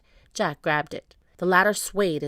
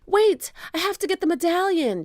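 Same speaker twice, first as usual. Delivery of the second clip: high, raised-voice call; urgent shout; 11 semitones higher, 5 dB louder than usual. A narrator's reading. Jack calling to Annie for a delay, shouting. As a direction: shout to hold a companion back. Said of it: "shouted Jack".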